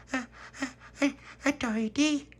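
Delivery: put-on very high, halting baby voice; babbling toddler. Baby Lucia tries saying Tony.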